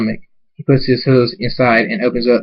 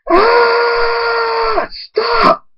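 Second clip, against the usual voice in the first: very high, drawn-out scream into stop. A narrator's reading. Hunter being cut by his captor, screaming. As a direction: scream it out in agony, far above your usual pitch. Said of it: "Hunter screams".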